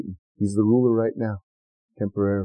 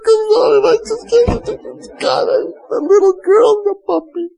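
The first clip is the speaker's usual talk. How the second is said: mock blubbering; 3 dB louder than usual. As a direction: ham up a teary breakdown over a TV ad. Mock crying over Hallmark commercial.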